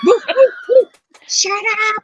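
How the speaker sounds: high, loud squawky woof-woof and shut up; squawky parrot yapping shut up; parrot barking like a dog. Retold or imitated. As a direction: mimic a parrot mimicking dogs. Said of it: "he barks like the dogs now".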